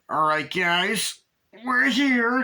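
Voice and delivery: slow, drawn-out high put-on dude voice; mock dude-bro announcement, slow and high; announcing arrival. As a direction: stretch it out like a goofy guy arriving. Retelling guy announcing arrival.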